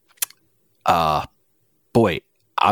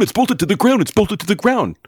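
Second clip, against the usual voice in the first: "ground" pitched up and insisted; higher insistent repeat; 12 semitones higher than usual. Acted. To Gray, insisting on the table, pitched up.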